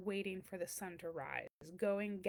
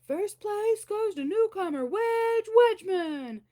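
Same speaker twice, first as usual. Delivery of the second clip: booming, high, slow announcer call; thunderous announcer; 10 semitones higher, 9 dB louder, slower than usual. Acted. Announcer declares the winner in a booming slow voice. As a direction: boom it out like a contest announcer. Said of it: "a thunderous voice making the dreaded announcement".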